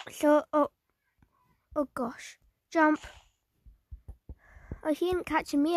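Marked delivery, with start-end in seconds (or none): 1.7-2.4 hushed scared whisper. Real, not acted. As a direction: breathe it out, hiding. Hiding from the killer.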